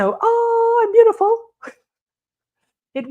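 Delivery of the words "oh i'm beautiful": vain diamond. Pretend. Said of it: "It didn't just say".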